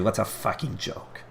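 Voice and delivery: slow, drawn-out mock of a teammate's gripe; a lightly voiced, badly faked Rasmus line; Rasmus impression. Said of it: "That was a terrible Rasmus impression".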